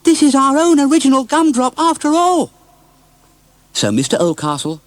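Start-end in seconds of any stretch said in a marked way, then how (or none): 0.0-2.5 put-on much higher, loud exultant child voice; joyful boy's cry. Robert celebrating finding Gumdrop, high joyful cry. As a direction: crow it with delight.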